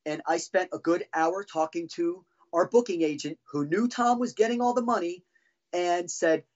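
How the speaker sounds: ordinary delivery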